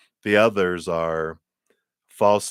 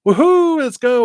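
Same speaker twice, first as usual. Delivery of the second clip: much higher and louder cheer; excited whoop; 10 dB louder than usual. Pretend. Waking up eager for the day, loud excited whoop. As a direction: burst out like you can't wait to start.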